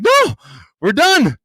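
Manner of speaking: high, loud mock outrage; retold outburst at the seller; loud protest. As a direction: yell it like the game is over. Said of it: "And I'm like".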